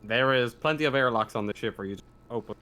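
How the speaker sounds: ordinary talk, nothing marked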